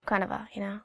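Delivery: ordinary delivery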